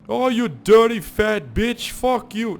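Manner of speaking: high, loud, drawn-out insult; slow mock-furious yell; imitated angry man. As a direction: shout it as if walking away. Retold fight, man's insult.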